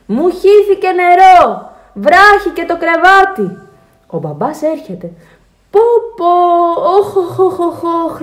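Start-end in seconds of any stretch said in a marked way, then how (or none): 0.1-3.6 high, loud, slow wail; child shouting. A narrator's reading. Alfons tells Dad about spilled water, shouting. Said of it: "φωνάζει ο Άλφονς". 5.7-8.2 high, loud lament easing off; dismayed father. A narrator's reading. Father finds water all over, dismayed lament.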